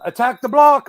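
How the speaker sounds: put-on loud British-accent shout; British accent yell. Fan yelling at actor in British accent. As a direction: yell it like a Londoner. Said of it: "in my Be-, British accent".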